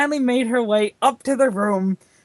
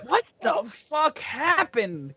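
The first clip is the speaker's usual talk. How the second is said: slow, softer groggy question; half-awake slow mumble; 5 semitones higher, 4 dB quieter, much slower than usual. A narrator's reading. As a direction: drag it out like just waking up. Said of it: "questioned the groggy Hockey".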